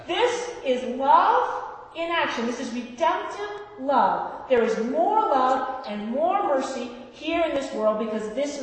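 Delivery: slow, loud preaching; full-force preaching. Acted. Preacher to congregation on redemptive love, slow loud preaching. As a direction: drive every word home from the pulpit.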